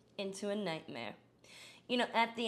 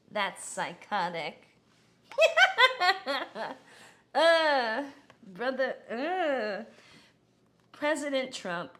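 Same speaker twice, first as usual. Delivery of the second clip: usual speaking voice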